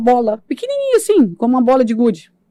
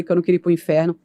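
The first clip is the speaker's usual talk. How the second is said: ordinary talk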